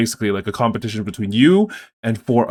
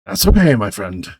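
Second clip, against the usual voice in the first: consoling official; 4 dB louder than usual. Acted. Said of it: "He's like".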